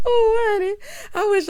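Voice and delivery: high, loud cackling laugh; loud high laugh running into oh honey; laughing hard. Real, not acted. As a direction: lose it completely laughing. Laughing at own joke.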